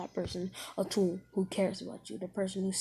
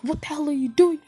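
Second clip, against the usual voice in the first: louder, higher mock outburst; voicing an outraged character, loud and high; outraged yell; 7 semitones higher, 11 dB louder than usual. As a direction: act out a startled, angry outburst. Character yelling in outrage in retold scene.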